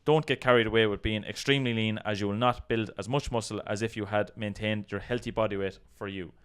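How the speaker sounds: ordinary talk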